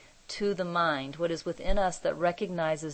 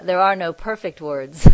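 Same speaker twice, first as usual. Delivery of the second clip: laughing; 3 semitones higher, 11 dB louder than usual. Real, not acted. Teacher laughing at her own point about words, amused. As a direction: laugh through the end of the line.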